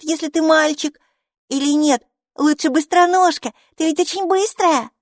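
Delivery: bubbling over with name ideas; eager little girl. Acted. Майки, маленькая девочка, с восторгом придумывает имена для Буки.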